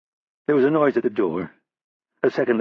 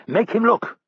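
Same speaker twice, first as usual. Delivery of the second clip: much higher sharp order; commanding; 11 semitones higher, slower than usual. Acted. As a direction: bark at his men. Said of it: "Roddy commanded".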